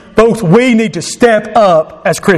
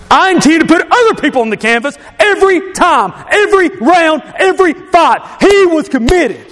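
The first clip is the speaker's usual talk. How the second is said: high, driven preacher delivery; ringing sermon declaration; impassioned preaching; 10 semitones higher than usual. Acted. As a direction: preach it with rising fervor, pitched high.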